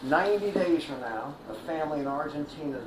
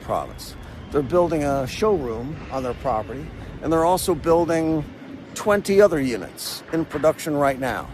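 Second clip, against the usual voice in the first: steady, clear narrator read; polished explainer-video narration; documentary voiceover; 7 dB louder than usual. Acted. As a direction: present the facts like a documentary host.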